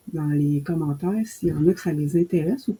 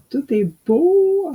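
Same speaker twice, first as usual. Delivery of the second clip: relieved delight; 10 semitones higher, 5 dB louder, slower than usual. Real, not acted. Relieved her sound works.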